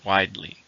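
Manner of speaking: "Widely" stressed on "Wide"; first-syllable stress. Shown as a demonstration. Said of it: "stress in the first".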